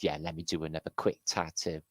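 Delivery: ordinary delivery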